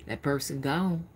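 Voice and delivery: ordinary delivery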